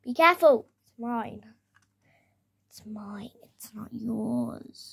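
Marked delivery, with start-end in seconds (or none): 2.7-3.3 hissed whisper, barely voiced. Fighting over the toy car. 3.6-4.9 slow, unvoiced whisper; taunting whisper, keeping the toy. Fighting over the toy car.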